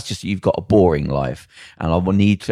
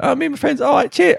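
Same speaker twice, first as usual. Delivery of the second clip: very high squeaky put-on voice; mock caller. Mimicking radio chat about going out, very high and squeaky. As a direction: squeak it like a giddy young caller. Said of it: "I enjoyed your impression".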